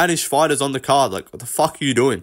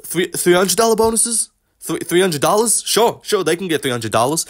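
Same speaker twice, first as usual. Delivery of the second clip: higher, puzzled mock voice of the boss, mishearing the number; 5 semitones higher than usual. Boss mishearing the fighters' bonus demand, higher puzzled mock voice. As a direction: play the promoter pretending he misheard the bonus figure. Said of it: "He's like".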